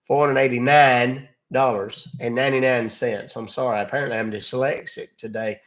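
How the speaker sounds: hammed-up Southern gentleman on the phone; folksy Southern caller. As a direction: play a blustery Southern customer. Prank caller in put-on voice.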